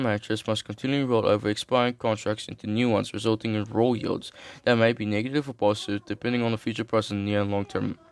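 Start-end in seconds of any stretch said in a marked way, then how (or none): none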